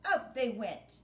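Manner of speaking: ordinary delivery, nothing marked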